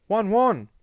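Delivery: high, loud squeal of delight. A narrator's reading. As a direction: squeal the pet name at full volume. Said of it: "there was a loud squeal of".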